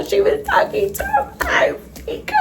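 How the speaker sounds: high, thin squeal fading out; mock wailing. Telling viewers her cousin was calling her ex, mock wail. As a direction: voice gives out in hammed-up heartbreak.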